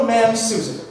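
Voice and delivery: ordinary talk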